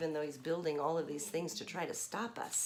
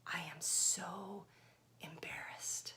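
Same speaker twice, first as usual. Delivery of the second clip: slow, soft whisper; embarrassed whisper; 2 semitones lower, 9 dB quieter, slower than usual. Acted. Grover admitting to the kids he is embarrassed, whispering. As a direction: whisper it shyly. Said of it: "He says".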